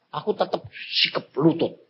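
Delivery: normal talking voice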